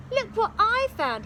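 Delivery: put-on excited boy. Jack proud.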